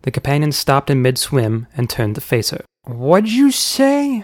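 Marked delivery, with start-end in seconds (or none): 2.9-4.2 high, drawn-out indignant challenge; affronted uncle. A narrator's reading. Uncle to the queen who seems to insult him, indignant. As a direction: stretch it out in high-pitched disbelief.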